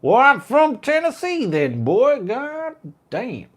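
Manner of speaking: very high, loud mock Tennessee accent; cartoonish Southern accent from Tennessee. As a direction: ham up a Tennessee twang. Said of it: "that sounds like Tennessee".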